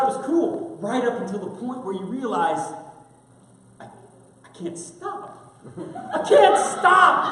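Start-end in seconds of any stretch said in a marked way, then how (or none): 3.8-7.2 high strained cartoonish strongman voice; exaggerated cartoon intonation. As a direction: overdo it like a cartoon muscleman. Arnold voice he can't drop.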